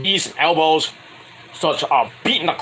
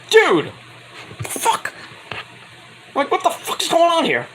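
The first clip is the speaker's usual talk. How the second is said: shocked disbelief; 12 semitones higher than usual. Retold or imitated. His own shocked reaction retold.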